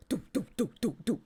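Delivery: rhythmic doop-doop thuds; imitated thudding footsteps. Streamer mimicking her heavy cat running, imitated thuds. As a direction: sound out heavy paws hitting the floor.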